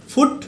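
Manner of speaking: "ফুট" with a short, quick u; short oo in "ফুট", for contrast; short u sound. Shown as a demonstration. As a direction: keep the vowel of "ফুট" brief. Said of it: "একটা হচ্ছে short উ".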